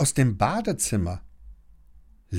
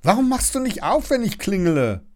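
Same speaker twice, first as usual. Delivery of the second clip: scolding; 10 semitones higher, 4 dB louder, faster than usual. A narrator's reading. Sister scolds brother.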